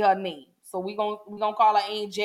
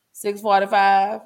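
ordinary delivery

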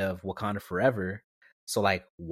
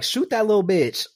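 high, loud retold yell; exasperated mock scream at a screen; 9 semitones higher, 5 dB louder than usual. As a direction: re-enact yelling at the TV. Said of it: "I literally screamed at the T V".

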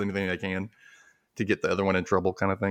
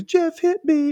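put-on much higher, louder, whiny kid voice; crying little brother; 5 dB louder, slower than usual. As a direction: tattle like a sobbing little boy. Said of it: "He goes".

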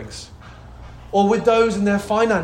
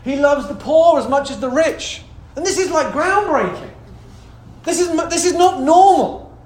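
high, raised preacher's voice; pitched up, building to emphatic declarations; fervent preaching; 6 semitones higher, 4 dB louder than usual. Real, not acted. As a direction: preach it excitedly to the house.

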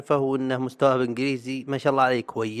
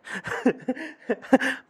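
laugh; 13 semitones higher than usual. Real, not acted. Laughs at grammar rule.

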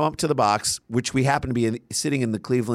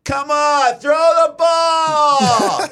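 much higher, loud impatient heckler yell from the stands; heckler's yell; 14 semitones higher, 6 dB louder, much slower than usual. Retold or imitated. Comedian imitating the fan yelling from the box at him. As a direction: bellow it like a fan fed up with the wait. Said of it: "you hear the guy in the background be like".

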